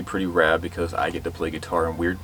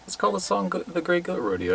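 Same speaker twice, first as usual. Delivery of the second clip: much higher, soft mock musing; lightly voiced inner thought, high and hushed; 11 semitones higher, 5 dB quieter than usual. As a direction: float the idea up high like a daydream. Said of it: "I kinda thought, like".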